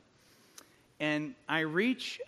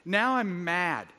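ordinary talk, nothing marked

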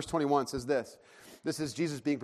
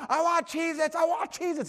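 put-on high-pitched child's tantrum yell; imitated screaming; 11 semitones higher than usual. Hungry son erupting on the way out, imitated screaming. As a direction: repeat it like a stamping toddler. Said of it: "he's screaming and yelling".

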